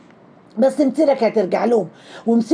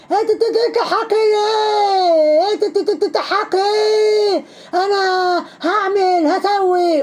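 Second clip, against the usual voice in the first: high wailing stutter imitation; machine-gun stutter breaking into long drawn-out high wails; hammed-up flustered man, stuck on syllables; mock stammering outrage; 9 semitones higher, much slower than usual. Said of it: "وقعد يتأتأ ومبرق عينه".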